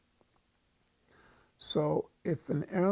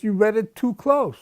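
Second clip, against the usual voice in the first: loud, higher pronouncement; examiner's loud correction; 6 semitones higher, 11 dB louder than usual. Retold or imitated. Examiner telling the cadet he read too close, loud. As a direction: state the fault firmly. Examiner voiced in retold story.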